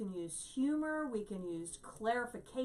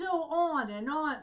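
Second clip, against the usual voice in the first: higher, louder mock griping; imitated complaining; 5 semitones higher, 5 dB louder than usual. Retelling a woman's endless complaints, mock whining. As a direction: drone on like a complainer.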